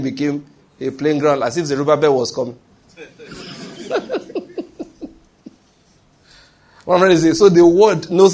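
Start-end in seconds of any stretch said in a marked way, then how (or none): 3.3-5.1 a burst of high laughter; amused high laugh. Real, not acted. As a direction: laugh out at your own joke. Laughs at his own joke.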